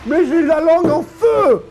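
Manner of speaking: ordinary talk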